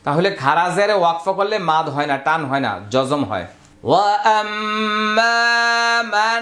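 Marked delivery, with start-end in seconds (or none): 3.9-6.4 very slow melodic Arabic chant; Quranic recitation. Shown as a demonstration. Teacher modelling the verse before explaining, slow chant. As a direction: chant the verse long and sustained. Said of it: "teacher recites verse again".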